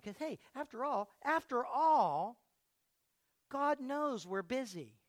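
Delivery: mock excuse. Preacher mocking the congregation's excuse, drawn-out and sarcastic.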